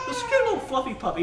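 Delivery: very high cutesy voice; imitating a softhearted guy gushing; Wayne. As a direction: coo it in a squeaky, high-pitched gush. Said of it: "and he's like".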